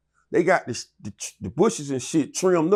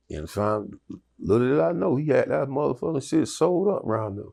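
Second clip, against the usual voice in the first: ordinary talk, nothing marked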